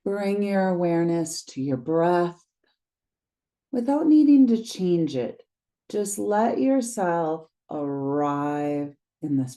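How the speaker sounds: calm guided meditation read, unhurried pauses. Acted. Teacher guiding listeners through a grounding practice, meditation voice. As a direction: lead them gently into the body.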